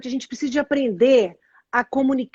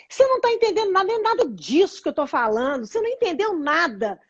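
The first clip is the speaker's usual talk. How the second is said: high-pitched mock complaint; exasperated wife; 5 semitones higher than usual. Wife complains to husband that he doesn't understand, exasperated. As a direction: complain at him, pitch jumping up. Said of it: "a gente chega pro marido e fala assim".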